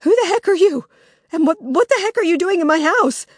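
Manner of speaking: high, loud, alarmed questioning; Lily confronting strangers in her house, shocked and high; shocked demand. Acted. Startled loud demand.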